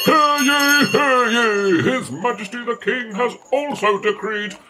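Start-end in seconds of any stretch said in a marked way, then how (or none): 0.0-1.8 loud ringing proclamation, ye olde crier; town crier. Pretend. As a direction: bellow it like a medieval town crier.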